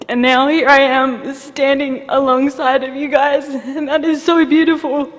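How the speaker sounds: emotional survivor thanking the audience, near tears; tearful. Real, not acted. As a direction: fight back real tears. Survivor's emotional thank-you speech.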